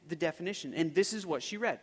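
ordinary talk, nothing marked

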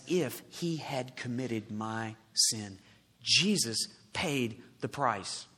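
solemn preaching. Real, not acted. Preacher explaining the price Jesus paid, solemn. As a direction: weigh each phrase slowly and deliberately.

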